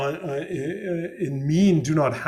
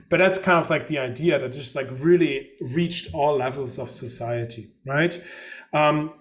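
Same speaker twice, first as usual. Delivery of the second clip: ordinary delivery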